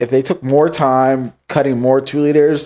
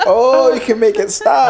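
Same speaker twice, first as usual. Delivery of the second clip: higher, laughing Minnesota parody; mock Minnesota accent; 7 semitones higher than usual. Friend mocking the Minnesota accent while laughing. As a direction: tease her Minnesota accent, pitched up. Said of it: "It's like".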